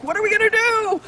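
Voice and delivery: high, loud hammed-up wail; mock panic. Voicing panicked pancake eaters.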